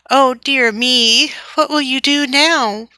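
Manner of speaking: put-on high, slow fretful little girl's cry; worried cry. Little girl running up to the barn, high worried cry. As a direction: fret aloud while running up. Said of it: "cried Flossie".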